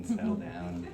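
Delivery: ordinary talk, nothing marked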